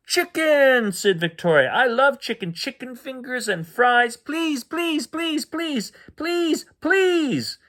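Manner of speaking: put-on high, excited child voice; a little girl begging for her favourite food; squeaky pleading kid; eager little girl.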